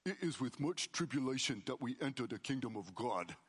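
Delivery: put-on a light thick New York accent; mild New York accent, dry and deadpan. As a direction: say it like a New Yorker pastor walking past. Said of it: "really thick New York accent".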